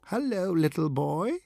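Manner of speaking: a put-on witch greeting a child; strained witch voice. A narrator's reading. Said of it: "her voice strained to sound witch-like".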